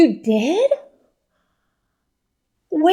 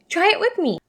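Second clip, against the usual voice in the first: ordinary delivery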